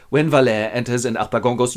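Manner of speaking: plain reading voice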